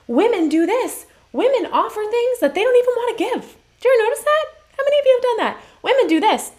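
high, loud and emphatic; worked-up. Real, not acted. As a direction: voice climbing high as you press the point home. Worked-up point about women's offers.

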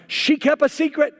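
high, loud tattling complaint; accusing groom. Retold or imitated. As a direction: rat her out to the pastor.

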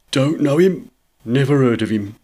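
curt shopkeeper brush-off; unhelpful tradesman, not interested; dismissive shopkeeper. A narrator's reading. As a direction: voice a disagreeable shop man brushing someone off. Gruff shopkeeper brushes off George.

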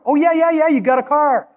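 high, pouncing delight; mock delight. Henry gleefully discovering the car, high and eager. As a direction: leap on the find with glee.

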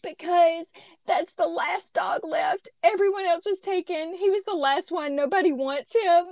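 mock tearful plea. Retelling her tearful answer to Daniel, mock sob-whine. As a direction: replay a weepy plea with a light touch. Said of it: "began to weep".

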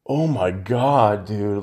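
slow, drawn-out retold amazement; stunned disbelief. Friend finding him after the fight, stunned. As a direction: stretch the words as if taking in a shock.

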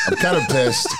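said through a high laugh. Real, not acted. Friend laughing while pretending to be upset. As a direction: laugh your way through the line.

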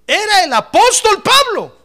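high, loud proclamation; high, ringing pulpit cry; preacher's climactic shout. Real, not acted. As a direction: proclaim it at full force to the congregation. Preacher climaxes the point about Paul.

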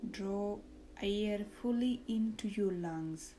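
soft, slow meditation voice; calm, hushed, lead them into relaxation; guided meditation. Acted.

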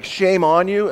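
mild condemning tone; scolding. Retold or imitated. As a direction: shame her, lightly accusing. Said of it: "one would be like".

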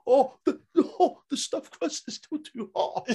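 high laughing pain cries; laughing burnt-mouth whine. Acted. Acting burnt mouth with pizza.

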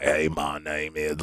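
slow imitation of a voice box pressed to the throat. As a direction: imitate someone speaking through an electrolarynx. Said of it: "they're talking like this".

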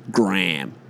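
"Graham" cut to one syllable, "Gram"; middle missed out. Shown as a demonstration. Mocking Americans who miss the middle, one-syllable name. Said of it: "completely miss the middle of the word".

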